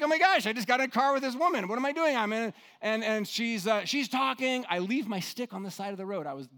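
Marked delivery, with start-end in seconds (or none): 0.0-2.2 fast, high, panicked inner voice; panicked inner thought. Retold or imitated. Traveler panicking after climbing into a stranger's car, fast and alarmed. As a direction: rattle it off in a rush of alarm.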